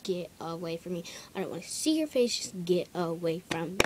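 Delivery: ordinary delivery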